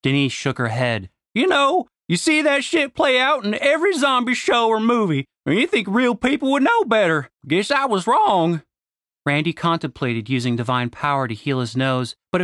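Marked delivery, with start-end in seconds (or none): 1.3-8.6 sardonic Southern woman. A narrator's reading. Denise, higher character voice.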